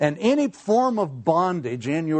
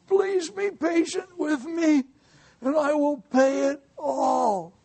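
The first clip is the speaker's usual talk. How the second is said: high, slow begging plea; pleading servant; 12 semitones higher, slower than usual. Acted. Servant pleading with his master for more time, high and slow. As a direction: beg for mercy, drawn out and high.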